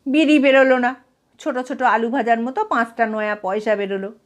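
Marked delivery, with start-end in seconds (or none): none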